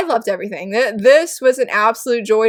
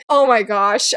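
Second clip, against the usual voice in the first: ordinary talk, nothing marked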